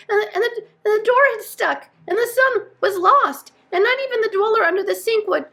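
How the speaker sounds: high, loud, tumbling list of woes; agitated, piling one grievance on another; overwrought stammering. A narrator's reading. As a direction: blurt it out until you're cut off.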